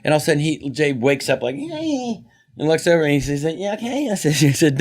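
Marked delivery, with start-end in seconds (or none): 1.5-4.1 high sleepy mock Leno; groggy Jay voice; imitating Jay Leno waking; Jay Leno impression.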